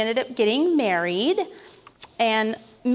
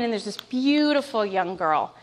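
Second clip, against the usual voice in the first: ordinary talk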